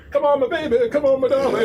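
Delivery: high, loud coaxing singsong; over-the-top high-pitched endearments; mock sweet-talk. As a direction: coo at an opponent like a dance partner, playfully. Mock sweet-talk while dancing with opponent.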